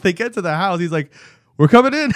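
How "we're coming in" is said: calling out high and loud with a laugh; loud announcement. Retold or imitated. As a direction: announce yourself loudly before walking in. Dad announcing before entering.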